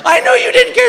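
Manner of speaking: very high sobbing repeat; hammed-up weepy echo; weepy wife repeating herself; mock crying wife. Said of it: "Here comes the tears".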